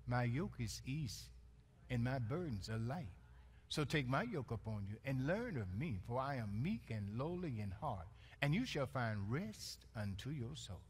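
low, soft, tender recitation; soft scripture reading. Acted. Pastor reading Jesus' words softly to weary congregation. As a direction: lay the words down gently.

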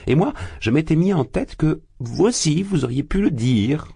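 jovial investigator. A narrator's reading. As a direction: brush off the suspicion with hearty cheer. Porphyri excuse.

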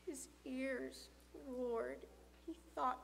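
plain reading voice

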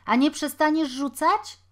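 loud, high scolding question; challenging call. A narrator's reading. Taller boy telling another to stop throwing, loud challenge. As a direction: call it out as a demand. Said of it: "zawołał nagle wyższy".

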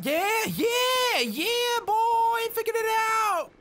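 high, triumphant shout; excited cheer. Real, not acted. Player cheers finding the shortcut, excited.

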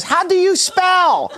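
high, drawn-out mock Indonesian outburst; slow, baffled Indonesian caricature, pitched high; bad Indonesian accent. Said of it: "forgive me for this bad Indonesian accent".

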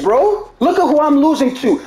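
normal talking voice

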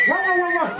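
the dog barks at Ojo Verde; dog barking. Acted. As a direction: bark playfully like a dog. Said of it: "el perro decía".

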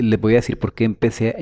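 ordinary talk

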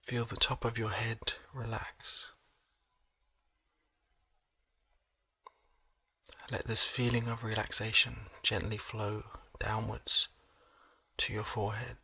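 soft, slow relaxation voice with long pauses; hushed, unhurried body-scan meditation; guided meditation. Acted. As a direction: gently guide the listener into calm, leaving space between phrases.

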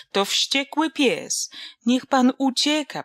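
put-on a girl shrieking in panic, pan rising; shrill terrified squeal. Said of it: "przeraźliwie zapiszczała Donka".

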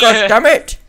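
loud, high outburst; exasperated shout. Real, not acted. Player fed up with the obstacle, exasperated shout. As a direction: yell it in exasperation. Yelling at a creature in the way.

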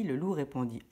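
ordinary delivery

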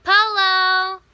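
loud playful shout; calling out. Shown as a demonstration. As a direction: shout it back like the game. Shouting the game reply.

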